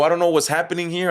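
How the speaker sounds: high, quick, exasperated protest; retold rising anger. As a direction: reenact protesting as anger starts to build. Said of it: "I started getting angry".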